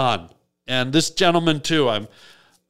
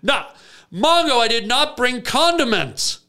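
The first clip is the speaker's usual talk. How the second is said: exasperated; 7 semitones higher than usual. Retold or imitated. Exasperated at friend's demands.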